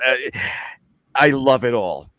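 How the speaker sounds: ordinary talk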